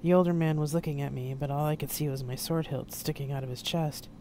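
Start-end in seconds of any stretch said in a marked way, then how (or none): none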